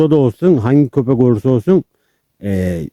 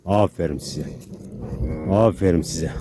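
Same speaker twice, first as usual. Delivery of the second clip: soft praise; 4 semitones lower, 8 dB quieter than usual. Real, not acted. Praising calmed dogs.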